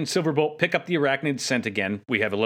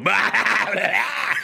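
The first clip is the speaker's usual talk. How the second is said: higher, louder mock shout; Inferno's roar; 6 semitones higher, 3 dB louder, much slower than usual. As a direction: bellow it like a crazed robot ant, half laughing. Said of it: "you just hear Inferno go".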